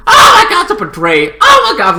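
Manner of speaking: very high, loud exclaiming; hammed-up shocked fangirl; mock outrage. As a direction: squeal it in disbelief. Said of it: "at the beginning I was like".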